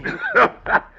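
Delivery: a high cackle; sly cackle. Acted. Captain Rat cackles at the startled young man. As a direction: cackle at the boy's fright.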